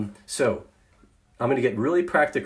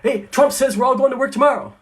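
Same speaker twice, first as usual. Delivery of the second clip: much higher, louder, alarmed outburst; an upset staffer bursting in with alarming news; agitated person; 12 semitones higher, 8 dB louder, faster than usual. Retold or imitated. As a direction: blurt it out in a rush of panic. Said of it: "Person comes in and says".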